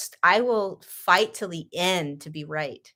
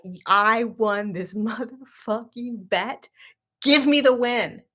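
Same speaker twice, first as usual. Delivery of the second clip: stubborn gloat; 4 semitones higher, slower than usual. Retold or imitated. Retold stubborn argument.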